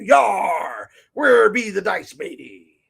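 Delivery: loud, slow hammed-up pirate shout. Shopkeeper hamming up a pirate for the dice box. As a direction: bellow it like a cartoon pirate. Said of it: "for the pirate in you".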